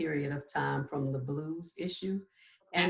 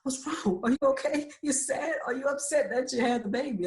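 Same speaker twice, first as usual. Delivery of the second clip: high, anxious rush of questions; freaking-out husband; 8 semitones higher, 4 dB louder than usual. Retold or imitated. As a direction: imitate a panicking husband, pitched way up. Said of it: "My poor husband starts freaking out".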